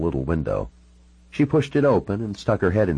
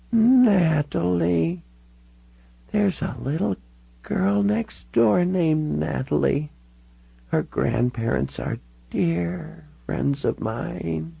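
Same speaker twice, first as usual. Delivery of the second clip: put-on high, very slow, reminiscing elderly voice; frail old lady lost in the past; confused old woman; 11 semitones higher, much slower than usual.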